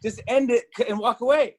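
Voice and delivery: high-pitched pleading at the screen; mock yelling. Host recalls yelling at her halftime show, mock yelling. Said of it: "I was yelling at the screen going".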